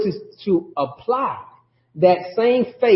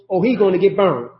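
usual speaking voice

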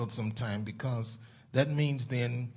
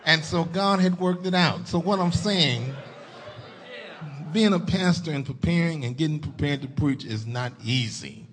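louder, raised preaching voice; 4 semitones higher, 6 dB louder than usual. Real, not acted. Pastor telling the church that preaching is hard, preaching. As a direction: drive the point out to the congregation.

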